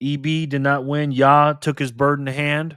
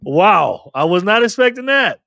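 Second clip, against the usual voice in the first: higher and louder, amazed; surprised; 6 semitones higher, 9 dB louder than usual. Real, not acted. Host reacting to a clip, loud surprise. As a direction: blurt it out, caught off guard.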